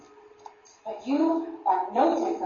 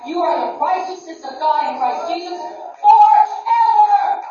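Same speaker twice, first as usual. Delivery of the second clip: higher, louder proclamation; preaching shout; 5 semitones higher, 7 dB louder than usual. Real, not acted. Preacher proclaims righteousness to the congregation, shouting. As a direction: ring it out to the hall. Preacher's climax over music.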